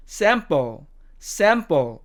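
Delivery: American "sample", front a; American demo of "sample"; American accent. Shown as a demonstration. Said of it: "미국식 발음으로는".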